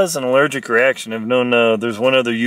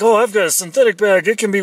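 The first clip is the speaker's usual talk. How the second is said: higher, quicker mock naive camper; lightly mocking a careless camper's reasoning; 6 semitones higher, faster than usual. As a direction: play the clueless camper, brightly and fast. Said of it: "So you think".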